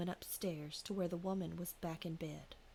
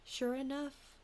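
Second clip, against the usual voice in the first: ordinary talk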